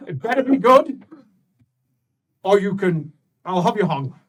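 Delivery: loud, high, furious warning; angry threat. Acted. Graf threatening Alric with hanging, loud and high. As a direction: threaten him at full volume.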